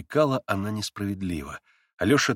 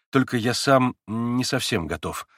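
normal talking voice

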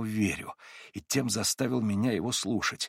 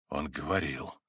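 firmly; 8 semitones lower, 7 dB quieter than usual. A narrator's reading. Ivan answering Alyosha firmly, lower, allowing no doubt. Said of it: "твёрдо произнёс Иван".

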